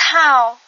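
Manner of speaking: Cow said loud and clear; Khmer word model. Shown as a demonstration. Teacher to learners, loudly modelling Cow. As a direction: announce Cow for learners to copy. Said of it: "cow signifie pantalon".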